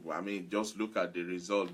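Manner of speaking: ordinary talk, nothing marked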